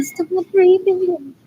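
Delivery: loud, high quoted plea; mock shout. Retelling siblings, quoting her own shouted plea.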